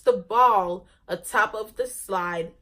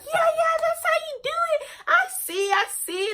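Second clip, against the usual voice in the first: much higher, fast mock cheering; friends cheering; faster than usual. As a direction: imitate the friends whooping, pitched way up. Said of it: "they're like".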